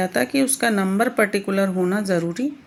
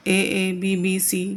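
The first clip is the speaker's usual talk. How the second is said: slow rhyme letters; much slower than usual. Shown as a demonstration. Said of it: "broke हो रही है rhyme".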